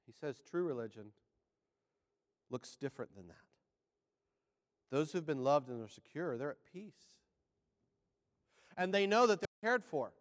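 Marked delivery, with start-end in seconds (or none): 0.1-7.2 low, soft preaching with pauses; hushed, reflective preaching. Real, not acted. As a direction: settle low and quiet to reassure them.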